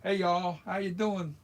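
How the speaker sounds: loud, high Southern greeting; Southern US accent. Shown as a demonstration. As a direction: holler it with a put-on Southern accent. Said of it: "you have a very Southern".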